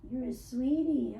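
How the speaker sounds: normal talking voice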